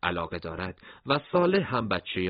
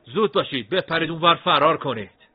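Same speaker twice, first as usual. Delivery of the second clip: loud, higher, urgent but friendly order; kind soldier's urgent whisper-shout; 8 semitones higher, 10 dB louder than usual. A narrator's reading. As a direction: smile and hurry the boys away. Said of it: "سرباز لبخندی زد و گفت". (سرباز مهربان با لحن عجولانه و بلند)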